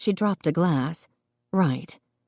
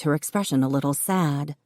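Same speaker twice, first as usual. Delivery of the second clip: usual speaking voice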